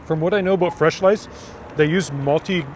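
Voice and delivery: chewing while talking; talking between bites; mouth full of pizza. Real, not acted. Seen 1: Cheeks full, jaw working after bite at -2; holds pizza plate.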